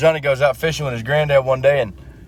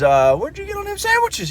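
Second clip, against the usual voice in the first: much higher, put-on old man; odd high grandpa voice. Grandpa eyeing the sandwiches, high put-on voice. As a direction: squeak it out like an old codger.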